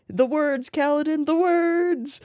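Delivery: rising fangirl outburst; excited fan cry. Pretend. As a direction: plead with the hero to say them. Fan quoting Stormlight, urging Akko.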